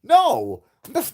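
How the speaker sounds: squeaky high flustered backpedal, mocking him; mocking Doctor Greer. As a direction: play him as a panicky liar scrambling to reassure. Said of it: "And he's like".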